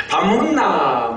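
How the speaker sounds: drawn-out mock Busan accent; Busan dialect imitation. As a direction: stretch it in playful Busan dialect. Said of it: "부산 사투리 쓰기에 바쁜 거예요". (길게 늘인 부산 사투리 흉내)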